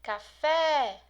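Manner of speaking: loud "Café", stretched on FÉ; calling out. Shown as a demonstration. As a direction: call out "Café", punching the last syllable. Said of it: "Você chama a palavra". (chamando alto "Café", com força no FÉ)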